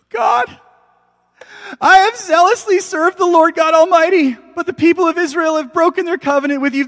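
high, loud desperate wail to God; anguished cry. Acted. Elijah pleading loudly with God in despair. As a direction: cry out like a man at the end of his rope.

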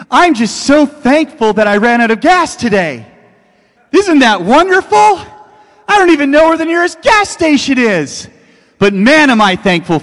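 high, loud, overjoyed sarcastic gushing; mock cheerful. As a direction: play the absurdly grateful victim. Mock interview answer after crash.

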